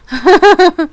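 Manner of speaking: short burst of high, loud laughter. Real, not acted. Streamer amused by own trouble speaking English, short laugh.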